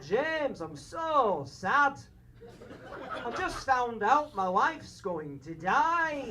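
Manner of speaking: put-on slow, comic North of England accent; sad northern English uncle. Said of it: "like, really sad".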